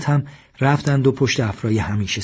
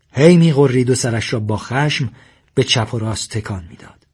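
ordinary delivery

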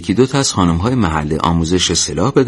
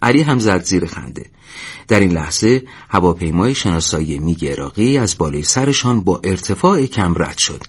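usual speaking voice

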